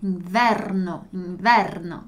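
inverno with a firm rolled R, slowly; strong rolled R. Shown as a demonstration. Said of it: "Importante la pronuncia della R".

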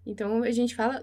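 normal talking voice

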